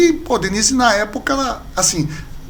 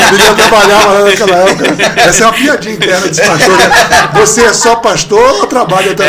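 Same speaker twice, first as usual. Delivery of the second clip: loud talk through laughter; real laughing; 9 dB louder than usual. Real, not acted. Pastor tells an inside joke of pastors, laughing loudly.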